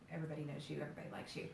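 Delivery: whisper. Real, not acted. Trailing aside, whispered.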